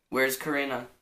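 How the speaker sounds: normal talking voice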